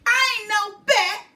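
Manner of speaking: put-on high, loud indignant girl; girl protesting. A narrator's reading. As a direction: object sharply like an offended kid. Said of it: "she protests".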